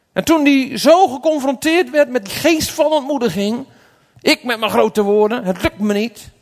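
loud, high-pitched declamation; impassioned preaching. Real, not acted. Preacher recalls Peter's failure to the congregation, impassioned. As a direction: drive each phrase home at full force.

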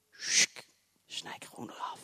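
whispered snipping noise; snip sound. Retold or imitated. Preacher mimicking a believer cutting out miracles, whispered snip. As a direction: cut it off with a hiss.

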